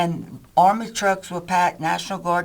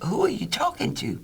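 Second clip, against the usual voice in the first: much higher, jittery imitation of a nervous friend; imitating his nervous buddy, high and flustered; nervous Doug; 11 semitones higher than usual. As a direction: mimic his flustered confusion. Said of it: "nervous like he is".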